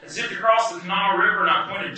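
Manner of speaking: plain reading voice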